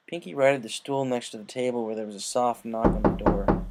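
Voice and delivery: plain reading voice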